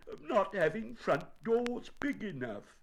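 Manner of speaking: high-pitched peeved bear; cross. A narrator's reading. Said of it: "said Winnie the Pooh crossly".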